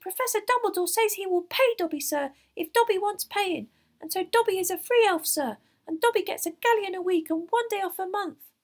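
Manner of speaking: very high, loud, squeaky elf; excited little servant voice; proud house elf. A narrator's reading. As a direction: announce it proudly in a shrill squeak. Narrator voices Dobby the house elf.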